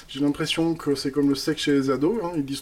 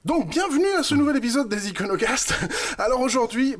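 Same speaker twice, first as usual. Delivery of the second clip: high mock announcer; 10 semitones higher, 4 dB louder than usual. Relance l'intro en voix aiguë.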